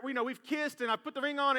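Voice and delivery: normal talking voice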